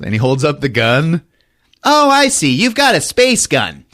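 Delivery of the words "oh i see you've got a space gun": high-pitched scornful reply; exaggerated, high sarcastic voice; mock sarcasm. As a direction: act out mocking disbelief. Sarcastic reply in acted exchange.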